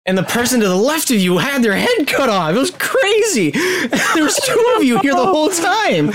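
high-pitched, gleeful storytelling; worked-up game master, near laughing; hysterical narration. Acted. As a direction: narrate gruesome events with the pitch climbing high. Game master cracks up narrating execution.

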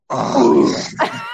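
loud airy gush; hammed-up weak-kneed surrender; mock swoon. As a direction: melt into an exaggerated sigh of pleasure. Melting at a bit of attention.

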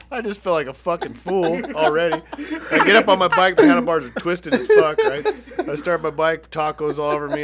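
loud, laughing retelling. Real, not acted. Telling friends about the crash, laughing loudly. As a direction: chuckle through the embarrassment.

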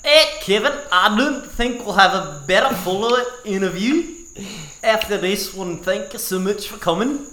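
high, loud put-on Welsh accent. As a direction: sign off in a bouncy Welsh accent. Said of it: "gotta do Welsh accents to sign off".

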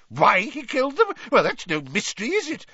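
high, loud, fast bluster. A narrator's reading. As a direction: scoff it off like a pompous minister. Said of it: "blustered Fudge".

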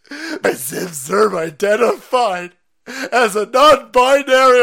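high, slow, very loud mock wailing; hammed-up sobbing outrage. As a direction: wail like an offended activist. Mock leftist crying outrage.

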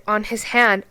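ordinary talk

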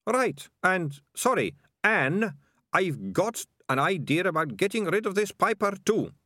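keen dim-witted crony; ratcatcher voice. Acted. Second ratcatcher, higher voice.

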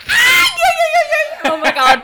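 very high, loud excited shriek. Retold or imitated. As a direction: squeal it out in delight. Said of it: "like, shrieked".

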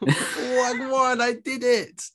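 Wagwan, I did it shouted high and loud; mock London slang. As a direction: ham up a loud London street voice. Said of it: "and he goes".

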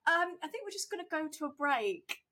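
uneasy presenter bailing out of the segment; awkward presenter. Retold or imitated.

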